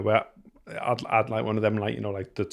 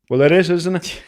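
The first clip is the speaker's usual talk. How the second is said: higher and louder, exasperated; exasperated retort; 7 semitones higher, 8 dB louder than usual. Retold or imitated. Father arguing bridges with toddler, exasperated. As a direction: hit the is in disbelief. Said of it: "And I'm like".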